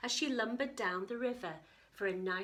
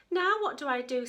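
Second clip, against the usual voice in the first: crossly, a bit louder; 5 semitones higher, 8 dB louder than usual. Acted. Lost girl asking herself crossly. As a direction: grumble it crossly. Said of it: "said Katie crossly".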